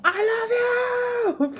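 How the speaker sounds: high, long drawn-out mock scream; squealed, stretched-out fan-style shout; imitated scream. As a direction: imitate a friend screaming it across a room. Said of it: "she starts screaming".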